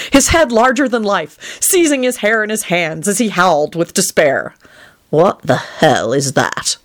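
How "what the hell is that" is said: low, slow disgust; nauseated. A narrator's reading. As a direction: drag it out with queasy distaste. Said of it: "said with a nauseated expression".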